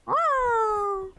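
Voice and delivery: ordinary delivery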